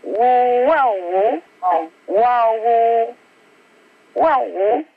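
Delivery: playful woo-wow calls like a dog; light mock dog sounds; dog-talk woofs. Shown as a demonstration. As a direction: imitate a dog answering, lightly. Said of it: "I say".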